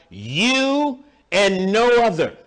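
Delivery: "You" stretched long and slow; slow, emphatic "You", then "no other". Acted. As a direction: proclaim it, leaning hard on "You". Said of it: "It's emphatic".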